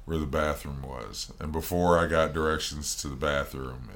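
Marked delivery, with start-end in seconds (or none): none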